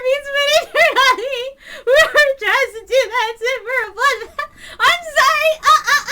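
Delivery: very high, loud laughing and squealing; laughing shrieks. Acted. Ritsuko squealing with laughter at the man, very high. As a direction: shriek through giggles. Woman laughing through her story.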